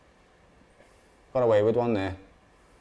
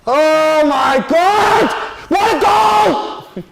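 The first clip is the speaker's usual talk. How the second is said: high, drawn-out disbelieving screams; ecstatic goal celebration; 11 semitones higher, 4 dB louder, much slower than usual. Real, not acted. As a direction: stretch each cry out at full shout. Still celebrating the wonder goal.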